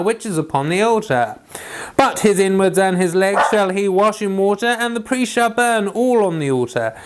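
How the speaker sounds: steady, measured Bible reading voice, pitched a little above his chat; scripture reading. Acted. Reading Leviticus chapter one to his viewers, measured scripture reading. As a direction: read the King James text aloud like a lesson in church.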